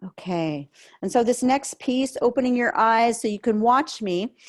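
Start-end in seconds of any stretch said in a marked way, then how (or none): 0.0-0.7 whisper. Real, not acted. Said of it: "whisper between sections".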